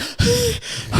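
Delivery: long, high exhale of relief; relieved sigh. Retold or imitated. Goose finally shot, relieved sigh. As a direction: let the tension out. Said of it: "And I'm like".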